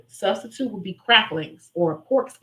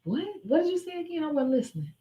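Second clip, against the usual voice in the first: high, softer, indifferent; bored, couldn't-care-less voice; unbothered; 7 semitones higher, 5 dB quieter than usual. Shown as a demonstration. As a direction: dismiss them airily.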